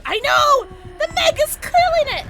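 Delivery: loud, high-pitched hype; high, loud cheering; excited yelling. Real, not acted. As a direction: shout your admiration. Hype over the Meg.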